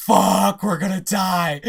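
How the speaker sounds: hammed-up fear; mock panic. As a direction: play the characters' sudden dread. Imagining characters' panic.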